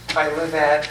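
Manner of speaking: ordinary talk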